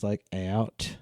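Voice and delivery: "out" with a stretched vowel glide; low, drawn-out Aussie "out"; Australian diphthong. Shown as a demonstration. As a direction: bend the vowel in "out". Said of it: "put a lot of, uh, like, a diphthongs".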